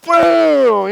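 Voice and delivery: high, loud shouted boom for the strike; mimicking the rooster's attack, loud boom; loud boom sound. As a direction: punch out a mock impact sound.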